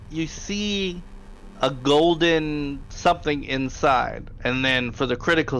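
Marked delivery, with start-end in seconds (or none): none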